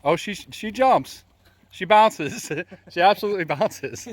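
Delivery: thrilled as the bridge bounces under you; excited. Real, not acted. Bridge bouncing under their feet.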